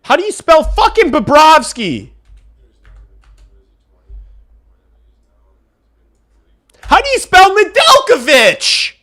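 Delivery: hollering at chat for a spelling; exasperated shout. Real, not acted. Streamer shouting at chat for a spelling, exasperated. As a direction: yell the question high and loud.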